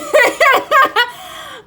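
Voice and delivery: high, loud real laugh; burst of laughter. Real, not acted. Reader laughing at her own graphic card image, loud laughter. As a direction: crack up helplessly.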